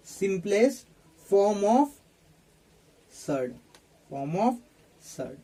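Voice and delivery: word-by-word dictation with long pauses. Real, not acted. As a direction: dictate in short chunks, repeating for note-takers. Dictates while writing on board. (रुक-रुक कर लिखवाते हुए)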